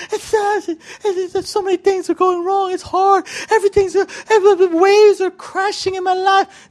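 high, whiny, flustered wailing; hammed-up frantic complaint; mock panic. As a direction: stammer in a high overwhelmed voice. Acts out our panicked complaining.

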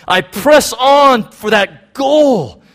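shouted high and loud, slow and driving; intense shout. Acted. Preacher shouting Paul's words to the congregation, intense and slow. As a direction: preach it out with full force. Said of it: "you can feel the intensity in his language".

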